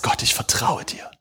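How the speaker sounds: a hushed, breathy whisper to God; whispered prayer. Pretend. Preacher voicing a believer's trust in his darkest hour, whispered. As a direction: whisper it as if alone in the dark.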